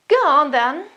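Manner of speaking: high, loud 'go on then'; punchy, emphatic yes; emphatic agreement. Shown as a demonstration. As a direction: agree emphatically, pitch jumping up. Demonstrates emphatic phrase of agreement.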